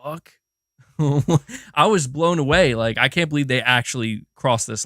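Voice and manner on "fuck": hushed, stunned what the fuck; stunned whisper. Retold or imitated. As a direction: breathe it out in shock. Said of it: "I literally was like".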